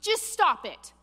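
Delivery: high, loud exasperated command; stern rebuke. Retold or imitated. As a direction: shut down his excuses hard. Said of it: "Samuel's response ... along the lines of".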